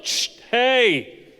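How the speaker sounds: loud hush, then high "hey"; sharp, comic trainer's correction; Jesus as dog trainer. Acted. Preacher playing Jesus correcting the sea like a dog, loud command. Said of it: "he just steps up and he's like".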